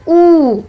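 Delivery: ऊ held long; long vowel ऊ. Shown as a demonstration. Teacher demonstrating the big vowel, long ऊ. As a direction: stretch ऊ right out. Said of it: "इसका उच्चारण".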